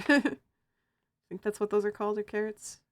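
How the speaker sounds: ordinary talk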